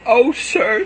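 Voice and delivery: high, loud pleading cry; pleading wail. Acted. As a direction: cry out to him, desperate. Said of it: "she cried".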